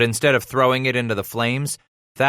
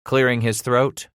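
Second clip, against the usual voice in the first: ordinary delivery, nothing marked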